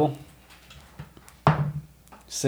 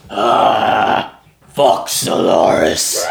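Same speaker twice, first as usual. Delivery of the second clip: loud, slow, lower monster voice; defiant orc; 4 semitones lower, 5 dB louder, much slower than usual. Acted. Burned orc cursing the enemy's god, loud defiant growl. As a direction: spit it out through the pain.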